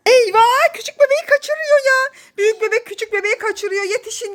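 high-pitched cry for help; playful alarm. Real, not acted. Mother narrates big baby abducting little one, mock alarm. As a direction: raise the alarm high, as if a kidnapping.

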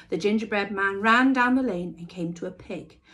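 plain reading voice

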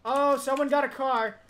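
loud, high alarmed exclamation; excited alarm. Real, not acted. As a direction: blurt it out in sudden alarm. Spotting an enemy vehicle.

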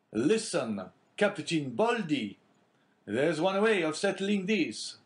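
high, slow, earnest sailor's offer; eager young sailor. A narrator's reading. Jacopo urging his captain to leave him behind, high and earnest.

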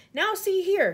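high, loud, commanding call; shouted demand. A narrator's reading. Police chief calling up to the creatures, shouting. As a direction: demand attention at full voice. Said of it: "shouted the s-, chief of police".

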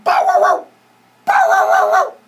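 very high, loud yapping; barking dog. A narrator's reading. Dog running after Danny and the dinosaur, high barking. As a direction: yap excitedly like a chasing dog. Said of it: "said a dog".

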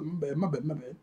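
sheepish apology. Retold or imitated. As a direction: back down under your breath. Sheepish apology after the kick.

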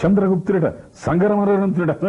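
high, grand mocking declamation; S V Ranga Rao as Chanakya. Shown as a demonstration. Mimic imagining Ranga Rao playing Chanakya, high grand declaiming. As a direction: thunder it like a scornful minister. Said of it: "SV Rangarao చాణక్యుడు వేస్తే ఎలా".

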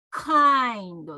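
loud, drawn-out "kind" with strong K; slow English "kind", K well released; back-of-throat K. Shown as a demonstration. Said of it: "喉の奥の方をね、意識して".

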